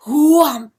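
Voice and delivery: loud booming wave sound; sea crash. Acted. Narrator voicing the sea.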